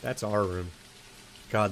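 ordinary talk

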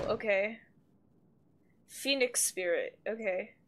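ordinary delivery

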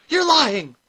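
high, very loud accusation; piercing cry of fury; furious shriek. A narrator's reading. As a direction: shriek it in outrage. Furious rival accuses Granny.

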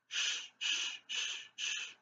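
soft, unvoiced shh, shh, shh, shh; whispered shh. Shown as a demonstration. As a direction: hush each rest in a whisper. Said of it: "This is a quarter rest".

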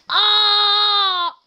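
long, high, loud mock scream; imitated scream. Retelling what a friend yelled, mock scream. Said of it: "and then Montez screamed".

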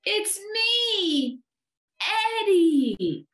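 put-on very high, drawn-out little-boy call; high cry of revelation. A narrator's reading. Eddie reveals himself to the bear, calling out. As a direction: announce it loudly, stretching the name.

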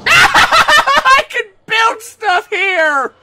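high, loud, laughing delight; excited laughing shout. Real, not acted. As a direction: shout it through laughter, thrilled. Discovers he can build.

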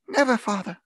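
high-pitched, earnest cry; children crying out. A narrator's reading. Children promising their father, crying out. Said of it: "cried they all".

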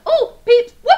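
high, loud happy squeal; delighted. Real, not acted. Delighted at next candy.

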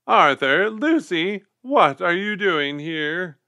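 high, loud, slow scolding; cross. A narrator's reading. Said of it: "she asked crossly".

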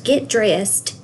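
ordinary delivery

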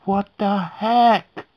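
high, loud startled cry; startled shout. Real, not acted. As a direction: jump at the scare and yell. Reacting to scary gif.

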